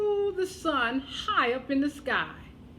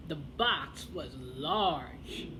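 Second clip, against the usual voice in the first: slow and grand; 9 semitones lower, 3 dB quieter, much slower than usual. A narrator's reading. Slow big description.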